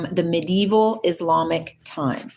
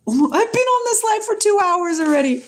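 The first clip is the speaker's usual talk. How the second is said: high, louder disbelief; surprised; 12 semitones higher, 3 dB louder than usual. Real, not acted. Realizing how long her live ran, high and surprised. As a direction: exclaim it high in shock.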